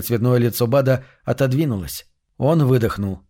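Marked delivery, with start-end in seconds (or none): none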